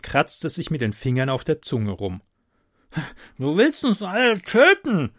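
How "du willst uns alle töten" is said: high, loud garbled advisor voice; mumbled with fingers in mouth. Acted. Advisor accuses with fingers in his mouth, mumbling. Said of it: "nuschelte er mit den Fingern im Mund".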